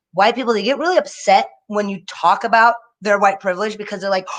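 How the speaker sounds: ordinary talk, nothing marked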